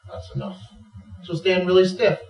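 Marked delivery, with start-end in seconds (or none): 0.1-0.6 low, soft warning; mother dog warning. Acted. Mother dog checking her puppy, quiet warning. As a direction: warn quietly, with restraint. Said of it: "and she'll go".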